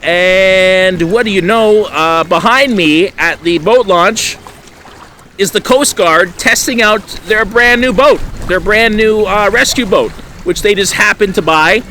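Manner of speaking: breathless excitement building as the story peaks; worked-up retelling. Retold or imitated. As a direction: relive the rush of that moment. Retelling the panic, voice racing up.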